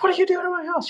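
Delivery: retold scream, all pitch and little volume; mock scream. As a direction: imitate an outraged homeowner screaming in a squeaky-high voice. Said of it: "like, screaming".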